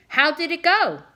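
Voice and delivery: high, loud eager question; eager wife. Acted. Wife greeting her husband home, high eager question.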